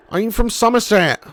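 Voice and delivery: farmer-style Somerset burr; Somerset accent. Shown as a demonstration. Streamer playing along with viewer's teasing, Somerset accent. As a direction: play the West Country yokel. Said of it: "Pig, you sound like [name]".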